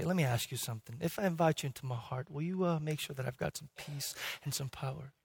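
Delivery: low, very soft, tentative asking; meek request. Retold or imitated. Seeker asking Jesus for peace and power, meekly. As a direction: shrink the voice into a small request.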